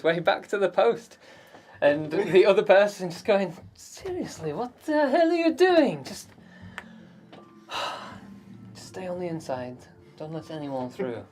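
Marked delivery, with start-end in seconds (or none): 3.7-6.0 high, incredulous hushed-then-rising reproach; exasperated guard. Acted. Guard confronts returning Matea, exasperated. 6.0-11.2 sigh, then a much softer order; weary resignation. Acted. Guard tells Matea to stay inside, weary.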